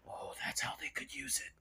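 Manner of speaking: whispered, plotting aside; a Disney employee whispering a sly idea; scheming whisper. Retold or imitated. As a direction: murmur it like an eavesdropper taking notes. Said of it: "monitoring this and going".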